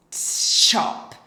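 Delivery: a whispered "t", then "shop" loud and breathy; t plus shop demo. Shown as a demonstration. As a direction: tap "t", then push out "shop". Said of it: "like this".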